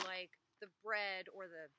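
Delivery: ordinary delivery, nothing marked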